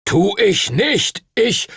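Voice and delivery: high, slow, loud angry protest; scolding. Acted. Duncan protests against Stuart's accusation, angry scolding. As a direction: bristle and fire back. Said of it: "schimpfte Master Duncan".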